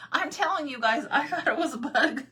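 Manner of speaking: high, loud, through laughter; helpless amused exclamation; laughing insistence. Real, not acted. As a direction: protest while cracking up. Laughing at her own panic.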